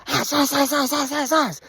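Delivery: high, loud repeated yelp; hammed-up beating noises; playful high war cry; mock battle cry. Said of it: "grabbed him by the horns and went".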